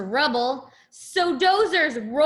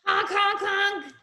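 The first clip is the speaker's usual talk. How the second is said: louder honks; 7 semitones higher than usual. Shown as a demonstration. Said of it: "Show me how you honk your horn".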